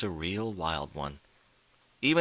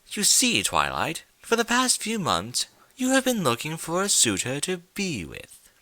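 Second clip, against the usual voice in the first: high mocking trickster; 8 semitones higher than usual. Discord explains mockingly.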